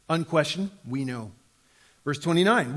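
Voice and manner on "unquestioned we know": ordinary talk, nothing marked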